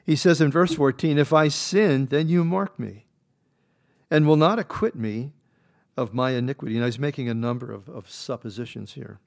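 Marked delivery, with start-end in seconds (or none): 1.2-6.8 scripture read at full force. Acted. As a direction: proclaim each line like a pulpit reading. Reads verse fourteen.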